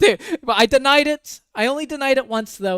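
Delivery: high, laughing, mocking his denial; laughing mockery. Mocking the boyfriend's denial, laughing. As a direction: laugh while mimicking him.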